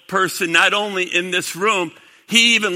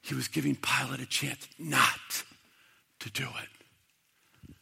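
whisper; 6 semitones lower, 12 dB quieter than usual. Acted. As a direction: sink the line down to a hush. Preacher drops to whisper.

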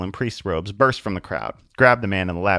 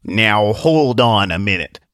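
objecting voice; 6 dB louder, slower than usual. Acted. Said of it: "a voice said from the crowd".

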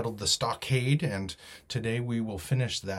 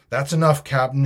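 louder, higher, jovial call; cheery shout; 4 semitones higher, 9 dB louder than usual. A narrator's reading. Long John accepts the captain's word, cheery shout. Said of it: "shouted Long John cheerily".

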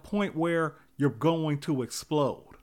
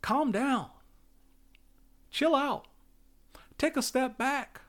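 wife calming him; 8 semitones higher than usual. Retold or imitated. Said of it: "My wife was".

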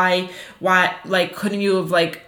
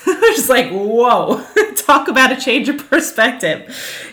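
laughing in amazement; 6 semitones higher, 5 dB louder, slower than usual. Real, not acted. Amazed at a survivor's outlook.

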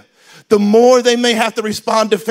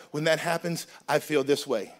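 soft, lower, measured complaint; gentle I-statement; 5 semitones lower, 9 dB quieter than usual. Shown as a demonstration. Spouse saying how it feels, soft and calm. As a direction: state the feeling calmly, no blame. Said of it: "find an I".

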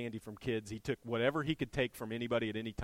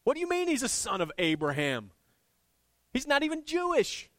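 louder, rising high with outrage; indignant leaders; 14 semitones higher, 7 dB louder than usual. Retold or imitated. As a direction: scoff it in mock outrage.

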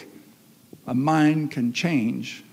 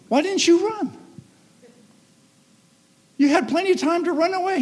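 much higher, put-on officer voice; puzzled officer; 13 semitones higher, 3 dB louder than usual. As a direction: ask it like a baffled cop, quick at the end. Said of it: "the officer looked back and said".

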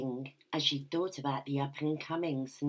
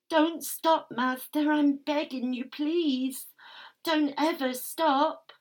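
higher and louder, desperate cries; frantic begging; 11 semitones higher, 7 dB louder, slower than usual. Acted. Sub crying out to Master not to stop, frantic and loud. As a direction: plead at the top of the voice, breathless.

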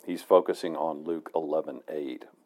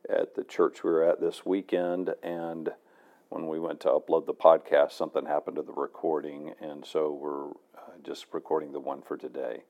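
ordinary talk

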